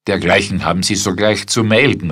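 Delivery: loud, fast general's rebuke; called out. A narrator's reading. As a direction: snap out the order. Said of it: "rief der General".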